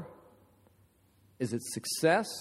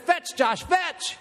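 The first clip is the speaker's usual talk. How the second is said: high, urgent call to a dog; imitated front-row chant, pitched way up; crowd command; 11 semitones higher, much slower than usual. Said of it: "all the people in the front row stand up".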